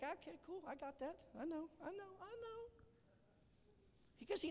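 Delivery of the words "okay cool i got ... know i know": high, light, breezy reassurance; Jesus answering Peter in a high, easygoing voice; casual Jesus. Acted. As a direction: brush it off like a friend who already knew. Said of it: "Jesus is like".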